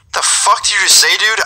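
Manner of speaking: can't believe what you just heard; shocked disbelief. Retold or imitated. Shocked reply to a death threat.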